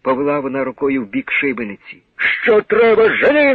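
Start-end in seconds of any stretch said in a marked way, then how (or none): 2.2-3.5 loud, high furious order; angry shout. A narrator's reading. Officer ordering her away, loud fearful fury. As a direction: snap the order out shaking with rage. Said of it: "тремтів від страху та люті".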